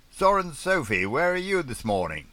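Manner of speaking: high, loud hail to another boat; radio hail. Acted. James hailing Soren Sophie on the radio, high and loud. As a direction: hail her across the ocean.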